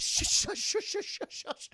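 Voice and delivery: rapid loud string of shushes; hissed repeated shush, no voice; urgent shushing. Acted. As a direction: hush someone insistently. Jackie Chan hushes the screamer.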